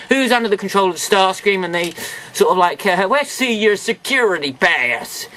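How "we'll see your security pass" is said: slow, lightly put-on Ironhide-style American accent; bad Ironhide accent. Toy reviewer imitates the robots' voice in a self-mocking Ironhide-style accent. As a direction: drawl the line like a cartoon Ironhide, badly. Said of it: "that was an atrocious accent".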